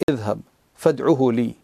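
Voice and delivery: usual speaking voice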